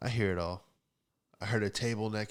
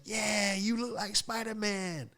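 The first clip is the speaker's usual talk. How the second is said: high, drawn-out mock female agreement; high-pitched put-on lady; lady impression; 11 semitones higher, slower than usual. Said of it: "She's like".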